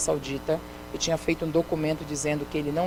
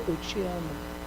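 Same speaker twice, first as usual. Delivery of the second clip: hushed, close to the ear, a lot softer; 10 dB quieter, slower than usual. Retold or imitated. God speaking into her ear, hushed and close. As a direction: breathe it softly as if right at someone's ear. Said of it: "Quando alguém fala assim no seu ouvido".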